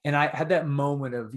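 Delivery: ordinary talk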